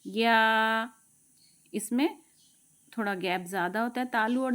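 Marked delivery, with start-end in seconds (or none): 0.0-0.9 louder یا from mid tongue; demo ya. Shown as a demonstration. Teacher demonstrating ya for students, louder. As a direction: lift the middle of the tongue for یا. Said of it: "بیچ میں سے".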